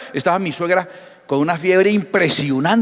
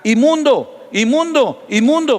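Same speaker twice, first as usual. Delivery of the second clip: gritado fuerte y alto; grito de leproso; 8 semitones higher, 4 dB louder, slower than usual. Acted. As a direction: grita como leproso avisando. (grito "inmundo" de leproso)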